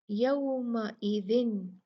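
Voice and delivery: यौमइदिन very slowly with a clear, unheld न; izhar, clear noon. Shown as a demonstration. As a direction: say the न of यौमइदिन clearly, no nasal hold. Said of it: "नून को साफ पढ़ दिया". (यौमइदिन, न साफ़, गुन्ना नहीं)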